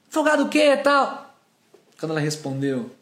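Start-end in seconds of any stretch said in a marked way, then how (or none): 0.1-1.2 indignant retort. Retold or imitated. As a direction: snap back as the offended woman. Retelling woman's indignant retort. (réplica indignada imitada)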